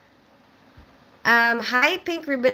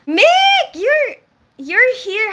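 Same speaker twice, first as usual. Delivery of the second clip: high, loud delighted squeal; squealing with joy at a friend's arrival; excited squeal; 13 semitones higher, 9 dB louder, much slower than usual. Real, not acted. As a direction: greet him like you're thrilled he came. Excited greeting to chat regular.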